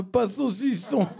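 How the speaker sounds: lisped "saucisson", the s sounds smeared toward sh. Acted. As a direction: lisp "saucisson" slowly, s and sh blurred. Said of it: "Non, monsieur".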